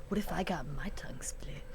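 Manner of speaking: hushed, secret question; whispering. Retold or imitated. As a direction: breathe it as a secret. Retelling whispered question to him.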